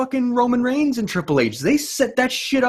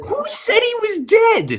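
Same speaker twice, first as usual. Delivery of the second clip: mock outraged shout; 14 semitones higher, slower than usual. Real, not acted. Co-host protesting the death joke, mock wailing shout.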